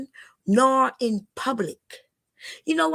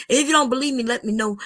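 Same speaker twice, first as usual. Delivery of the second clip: normal talking voice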